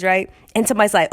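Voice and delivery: normal talking voice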